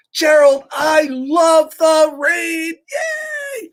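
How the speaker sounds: high, slow, gleeful shout about the rain; high squealing yay at the end; excited Piggy. Acted. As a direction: squeal with joy like a happy pig. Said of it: "And Piggy's like".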